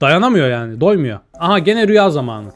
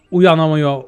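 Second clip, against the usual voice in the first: ordinary talk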